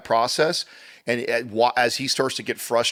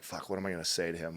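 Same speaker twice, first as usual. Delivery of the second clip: muttered inner thought; 4 semitones lower, 12 dB quieter, faster than usual. Retold or imitated. Inner thought while watching video.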